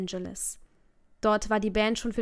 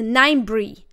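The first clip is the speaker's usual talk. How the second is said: louder, sharp angry snap; father snapping at his wife, furious; hissed at mom; 10 dB louder than usual. Acted. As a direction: cut her off in anger. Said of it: "fauchte er meine Mom an".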